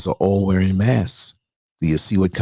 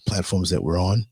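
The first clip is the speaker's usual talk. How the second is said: normal talking voice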